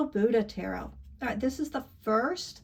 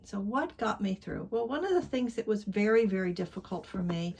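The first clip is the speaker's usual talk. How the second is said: usual speaking voice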